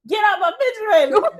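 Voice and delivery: loud, high mock-nagging shout; sudden hammed-up outburst; exasperated parent yell. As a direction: play a fed-up mother yelling, half laughing. Acted nagging parent blows up.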